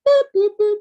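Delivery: high, loud phone-key boops; three loud beeps, voiced by mouth; imitated dialing beeps. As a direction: mimic keypad tones with high-pitched boops. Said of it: "Let me use my cell phone dialing powers".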